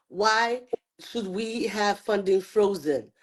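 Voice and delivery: ordinary delivery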